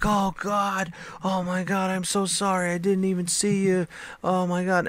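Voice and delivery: high-pitched imitation of a frantic apology; mock panic, voice held way up high; nervous, scared driver. Driver apologizing to the kid he hit, scared. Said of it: "He was so nervous and so scared".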